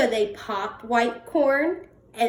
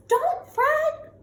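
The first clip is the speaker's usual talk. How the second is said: much higher, squeaky and comforting; squeaky mouse; 13 semitones higher, slower than usual. Acted. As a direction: squeak to cheer up the bear. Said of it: "Mouse squeaks".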